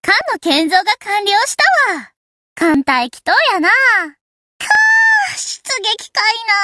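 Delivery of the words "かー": exasperated groan. Acted. Groan at being sent out.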